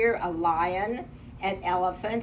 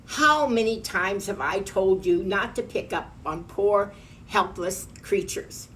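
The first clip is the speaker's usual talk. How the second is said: plain reading voice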